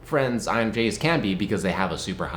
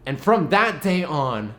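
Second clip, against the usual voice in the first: ordinary delivery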